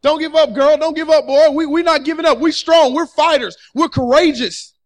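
loud, high rallying cry; fired-up church call to never quit; like you mean it. Shown as a demonstration. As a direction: shout it to your neighbor with conviction. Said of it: "tell him like you mean".

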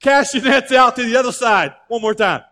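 loud hail across the water, voice pushed out; calling from shore. Retold or imitated. Jesus hails the fishermen from the shore, loud call. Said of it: "he yells out from the shore".

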